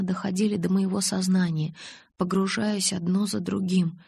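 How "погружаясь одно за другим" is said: ordinary delivery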